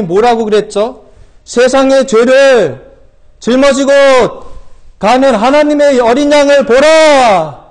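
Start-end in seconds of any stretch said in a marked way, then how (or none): 1.4-7.6 slow, high, drawn-out shout; loud proclamation. Acted. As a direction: cry it out like John the Baptist announcing to a crowd. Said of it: "하고 외쳤습니다".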